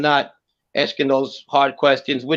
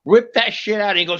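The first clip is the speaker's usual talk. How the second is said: ordinary delivery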